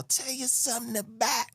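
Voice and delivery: high, quick Southern belle voice; a sweet old Southern belle with game; old lady voice. Retold or imitated. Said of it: "she a Southern belle".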